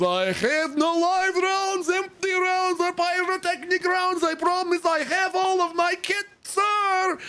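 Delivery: very high, slow, pleading recruit voice; scared recruit pleading to the screaming sergeant; panicked recruit recital. Retold or imitated. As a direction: beg through the declaration like a terrified recruit. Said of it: "And he goes".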